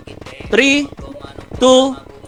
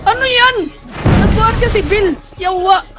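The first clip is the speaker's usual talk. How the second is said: startled outburst; 9 semitones higher, slower than usual. Real, not acted. Player reacting to a teammate's blunder, startled. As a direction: yelp it up high in disbelief.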